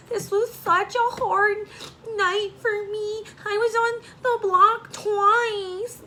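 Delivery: mock boo-hoo whine. Vlogger mocking Nicole's complaints in a whiny high voice. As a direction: ham up her self-pity, drawn out. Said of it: "she even wanted to boo-hoo about".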